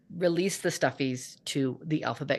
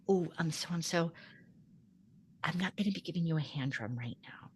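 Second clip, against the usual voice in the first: hushed, unvoiced whisper to a child; quiet whisper; 6 dB quieter than usual. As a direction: whisper it gently so only the child hears. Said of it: "I very quietly go up to".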